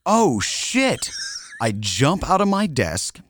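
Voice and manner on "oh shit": much higher, startled cry of alarm; startled exclamation. Retold or imitated. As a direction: blurt it out, shocked at the ceiling. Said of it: "I look up at the ceiling and exclaim".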